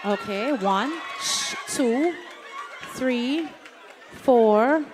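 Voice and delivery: loud counting to the crowd; calling out over music. Real, not acted. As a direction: project it over the noise to the whole room.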